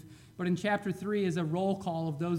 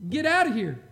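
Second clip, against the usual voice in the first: firm loud dismissal; 3 semitones higher, 8 dB louder than usual. Retold or imitated. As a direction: Get out of here, louder, with the stress on out. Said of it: "And he said".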